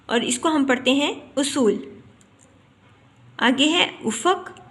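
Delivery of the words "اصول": "اصول" opening on a short u, as usool; correct pronunciation. Shown as a demonstration. Teacher gives students the correct reading, "اصول" starting with u. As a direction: say "اصول" with pesh on the alif: u-sool. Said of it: "الف کے اوپر پیش پڑھتے ہیں".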